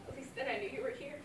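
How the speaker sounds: ordinary delivery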